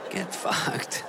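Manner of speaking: whispered mock insult. Real, not acted. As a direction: hiss the insult under your breath. Comic's rude reply to dog.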